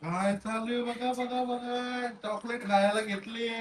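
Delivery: high, soft, cooing voice for a little niece. Real, not acted. Uncle catching his niece eating chocolate, cooing high and soft.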